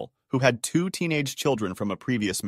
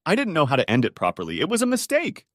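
stammering; 6 semitones higher than usual. A narrator's reading. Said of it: "Jerry stammered".

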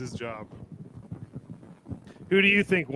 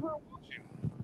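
whispered aside; 17 dB quieter than usual. Real, not acted. Aside to partner on stream.

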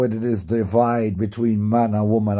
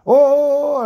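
imitated prayer drone; 7 dB louder than usual.

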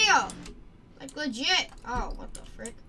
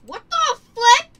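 surprised yelp; 11 semitones higher, 13 dB louder than usual. Real, not acted. Sudden surprise in game.

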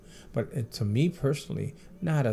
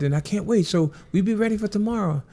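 pitched-up Michael impression, thrilled; imitating Michael; 9 semitones higher, 3 dB louder than usual. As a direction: lift the pitch into his voice, gushing. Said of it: "He said".

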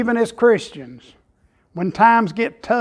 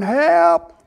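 a man dangling from a cliff, hollering for help; 10 semitones higher, much slower than usual. Retold or imitated. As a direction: yell up the cliff for help, hammed up. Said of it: "he was hollering".

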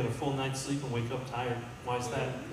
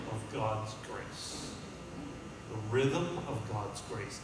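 slow, hushed reading of the rhythm of God's grace; slow and soft; 4 semitones lower, 7 dB quieter, slower than usual. Acted. Preacher reading scripture to his flock, slow and hushed. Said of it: "I like in the Message Bible".

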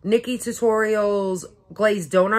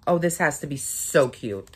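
ordinary talk, nothing marked

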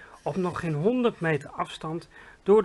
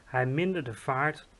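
ordinary delivery, nothing marked

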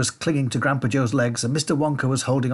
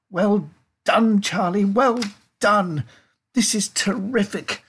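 high, drawn-out praise; pitched up, leaning hard on each cheer; excited congratulation; 9 semitones higher, 4 dB louder, slower than usual. A narrator's reading. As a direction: heap on the praise with delight. Wonka excitedly congratulating Charlie.

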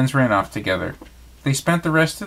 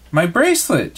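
sudden high gasp of alarm; a storybook gasp, higher and brighter; 6 semitones higher, 3 dB louder than usual. Acted. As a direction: blurt it out as you notice it's gone. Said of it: "she gasped".

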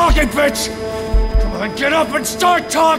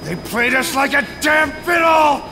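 high, loud outburst of rage; furious shout; 4 dB louder than usual. Acted. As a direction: shout it in outrage at being fooled. Acted enraged accusation.

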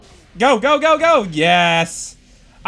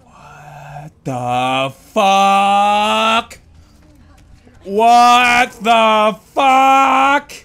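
very slow drawn-out disbelief, rising louder; stunned disbelief; 4 semitones higher, 4 dB louder, much slower than usual. Real, not acted. Gamer stunned at a sudden game turn. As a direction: stretch each word in shock.

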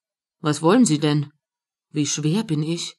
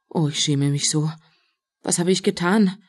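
ordinary delivery, nothing marked

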